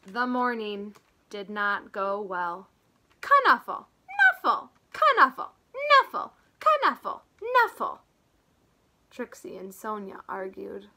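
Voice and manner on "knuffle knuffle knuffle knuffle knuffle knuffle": put-on high, loud childish squabble; two kids fighting over a toy; squabbling. Trixie and Sonia quarrelling, loud and high. Girls arguing over bunny.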